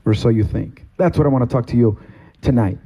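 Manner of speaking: ordinary talk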